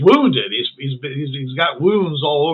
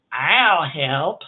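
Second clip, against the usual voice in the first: put-on old crone voice; 2 semitones higher than usual. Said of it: "the old crone says".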